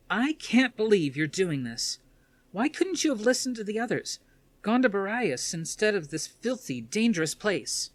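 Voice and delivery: a daughter storming in to confront her father; upset daughter. Acted.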